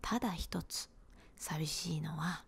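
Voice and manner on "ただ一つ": ordinary delivery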